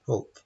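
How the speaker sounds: HELP squashed short, almost no E; unstressed, vowel removed. Shown as a demonstration. Teacher showing unstressed syllable, HELP with vowel taken out. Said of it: "Can we say it without a vowel".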